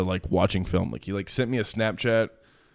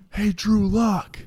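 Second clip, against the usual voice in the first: high, slow shout across the street; mock call-out; 12 semitones higher, much slower than usual. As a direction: hail him from a passing car. Frat guys calling from car.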